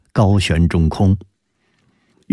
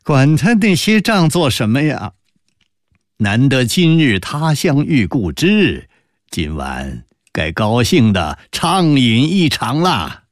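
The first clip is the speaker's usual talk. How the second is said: higher, lively amused chiding; 5 semitones higher than usual. Acted. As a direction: brush it off cheerfully and urge a friend on. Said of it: "高士奇哑然失笑道". (失笑着高声劝朋友)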